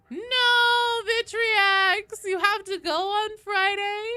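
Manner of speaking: high, loud drawn-out "no" of dismay; big theatrical cry of disappointment; playful wailed protest. Real, not acted. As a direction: wail it in mock despair, high and loud. Playful protest at viewer leaving.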